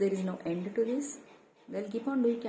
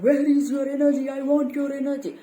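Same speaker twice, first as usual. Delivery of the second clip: loud, higher, entitled demand; mock demanding voice; 6 semitones higher, 11 dB louder than usual. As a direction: bark it like a greedy parasite. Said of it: "demanding arrogance".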